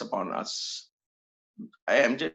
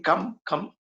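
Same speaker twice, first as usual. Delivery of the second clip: inviting call; 5 semitones higher than usual. Retold or imitated.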